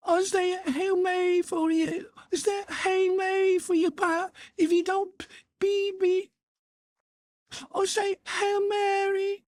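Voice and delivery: put-on very high, crying child's pleading; shaking with fright. Beaten boy begs his father with prayers, high and tearful. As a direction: beg tearfully not to be beaten. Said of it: "his voice shook with fright".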